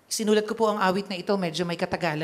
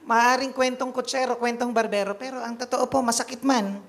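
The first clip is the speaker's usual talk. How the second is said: louder, raised preaching voice; impassioned preaching; 3 dB louder than usual. Acted. Priest to congregation, preaching with full force. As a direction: drive the point home to the flock.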